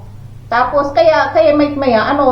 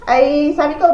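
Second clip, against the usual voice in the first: normal talking voice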